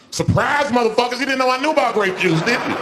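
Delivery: high, loud gotcha; gloating shout. Acted. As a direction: crow it over them. Gloating reveal.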